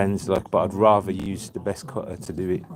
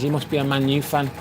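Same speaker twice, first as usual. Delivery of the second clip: put-on Brummie accent, a little higher, teasing; mocking Brummie accent; 4 semitones higher than usual. Man teasing a newcomer over his football team, mocking Brummie accent. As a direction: take the mickey in a Brummie accent. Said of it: "Taking the mickey, Brummie accent".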